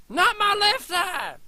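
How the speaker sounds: high, slow, loud pained scream; shrieking in pain; agonized scream. Acted. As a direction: scream as the eye is stabbed. Victim screams during attack.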